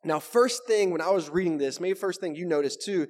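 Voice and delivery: ordinary talk, nothing marked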